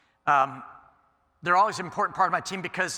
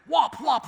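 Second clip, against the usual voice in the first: wing flap imitation; 11 semitones higher, 5 dB louder than usual. Imitating the eagle's wing beats.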